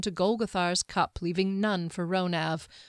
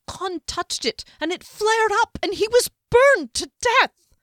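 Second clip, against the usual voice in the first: much higher, louder, rising to a shriek; 10 dB louder than usual. A narrator's reading. Servant telling the wizard of the fire, shrieking. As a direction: climb into a panicked shriek. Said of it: "Ronav had reached shrieking pitch".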